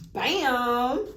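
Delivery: teacher showing an exclamation with one word; excited bam. Shown as a demonstration. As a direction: slam it down with energy. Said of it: "when I said bam, what was that?".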